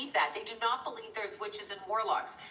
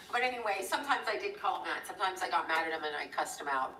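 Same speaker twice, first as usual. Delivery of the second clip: usual speaking voice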